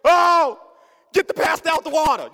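high, loud panicked cry; mock panic. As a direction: imitate a whole family panicking. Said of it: "my whole family's in panic".

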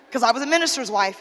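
normal talking voice

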